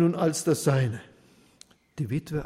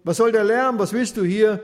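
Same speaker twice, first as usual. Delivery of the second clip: loud, higher, furious bark; a judge woken from his nap, yelling; loud angry snap at the door; 6 semitones higher, 9 dB louder than usual. Acted. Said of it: "Der Richter kam erbost zur Tür".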